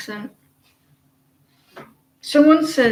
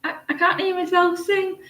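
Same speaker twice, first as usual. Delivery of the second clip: higher-pitched mimicked complaint; mock singer's dismay, pitched up; imitating a singer; 8 semitones higher than usual. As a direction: quote her in a raised, put-on voice. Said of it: "and she was like".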